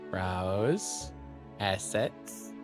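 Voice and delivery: normal talking voice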